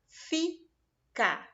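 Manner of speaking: two clear beats in "Fica"; syllables shown. Shown as a demonstration. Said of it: "abriu a boca duas vezes". ("Fica" em duas sílabas marcadas)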